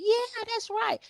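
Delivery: high-pitched agreeing client; mock client. Client agreeing to the pitch, high mock voice.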